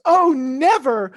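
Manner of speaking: slow, loud, high mock refusal; hammed-up princess, drawing out each word; melodramatic Aurora. Aurora turning down the prince, melodramatic mock refusal. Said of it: "And she goes".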